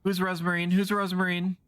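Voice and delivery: loud, high, frantic demand; frantic shout. Acted. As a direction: shout the question at her. Voicing a frantic game character.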